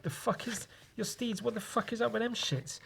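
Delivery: high, soft, incredulous mock voice; baffled hero. Retelling his comic, hero baffled by the steeds, high and soft. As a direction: ask it high and hushed, bewildered. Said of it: "And he's like".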